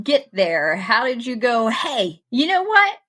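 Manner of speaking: ordinary delivery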